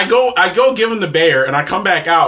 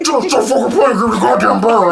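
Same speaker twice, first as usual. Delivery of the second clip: higher, louder, laughing demand; laughing through an angry impression; mock angry demand; 7 semitones higher, 4 dB louder than usual. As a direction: imitate an impatient dad barking orders. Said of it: "He's like".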